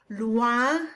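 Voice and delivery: "Loin" with oi said wa, rising; teacher modelling the wa in "Loin". Shown as a demonstration. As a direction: say "Loin" as lwa with a nasal end. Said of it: "the O I says wa".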